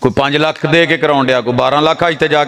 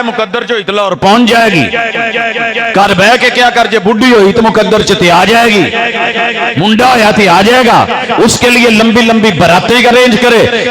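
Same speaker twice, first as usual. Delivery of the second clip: raised, driving sermon voice; fiery preaching; 6 semitones higher, 3 dB louder than usual. Acted. Preacher scolds people who work for everything but religion, fiery. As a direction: build the list up with fervour.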